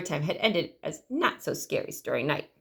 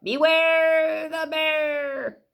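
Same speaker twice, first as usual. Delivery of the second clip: growly bear voice; 7 semitones higher, much slower than usual. A narrator's reading. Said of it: "a great growly voice called out".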